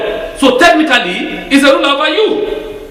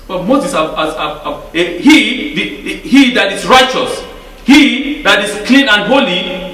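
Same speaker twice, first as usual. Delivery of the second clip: normal talking voice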